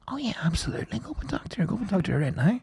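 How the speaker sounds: high, soft, eager voice; lightly imitated keen yes; retold eager encouragement. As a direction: urge them on in a light high voice. Retells a woman's eager encouragement.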